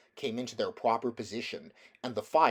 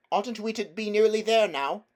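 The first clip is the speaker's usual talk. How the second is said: put-on higher, louder girl's voice, anxious question; a worried girl asking in the dark; young girl; 11 semitones higher, 7 dB louder than usual. Polly asking Diggory in the dark if they are nearly there, a higher worried girl's voice.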